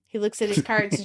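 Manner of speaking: ordinary talk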